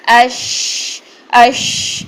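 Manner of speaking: "اش" with breath and sound running on; flowing thin sheen. Shown as a demonstration. Tajweed teacher demonstrating flowing breath, thin sheen. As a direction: hold the sh of "اش" long and light. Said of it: "سانس بھی جاری ہو رہی ہے".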